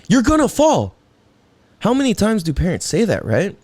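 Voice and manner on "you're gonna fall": imitated parent's alarmed warning, much higher; mock worried parent. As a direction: squeal the warning like a nervous parent. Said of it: "And it's like".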